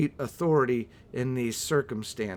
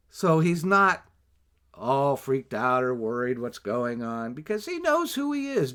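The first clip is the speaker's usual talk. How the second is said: ordinary talk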